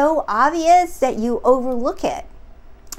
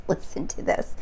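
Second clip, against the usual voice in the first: whispered aside; 8 dB quieter than usual. Real, not acted. Aside before quoting the clip.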